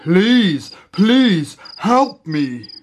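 slow, loud lion plea; begging. A narrator's reading. Trapped lion to departing monkey, begging loudly. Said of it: "the lion begged him again".

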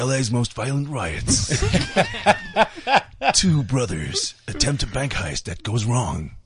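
slow, deliberate movie blurb; measured trailer voiceover; trailer-style read. Shown as a demonstration. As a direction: take your time over every phrase. Reading trailer copy aloud slowly.

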